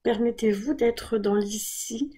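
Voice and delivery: ordinary talk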